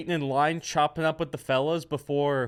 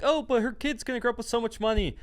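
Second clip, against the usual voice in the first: mocking voice; 12 semitones higher, faster than usual. Mocking people defending her.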